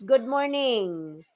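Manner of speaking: normal talking voice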